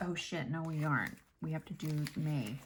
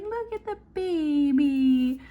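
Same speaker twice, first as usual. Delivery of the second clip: put-on high, slow, louder baby-talk coo; doting daughter; 9 semitones higher, 8 dB louder, slower than usual. Mom imitates her daughter cooing over the caught baby animal. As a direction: gush over the tiny animal like a proud kid. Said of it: "and she's like".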